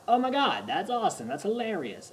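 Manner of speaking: high, bright burst of delight that settles down; excited gushing. Retold or imitated. Reacting to a hilarious Ben Stiller scene, excited gushing. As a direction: gush like a delighted fan. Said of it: "Sometimes I'm like".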